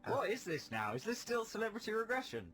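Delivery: high-pitched bafflement; incredulous question. Real, not acted. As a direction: ask in disbelief, voice rising. Host questions the collapsed act.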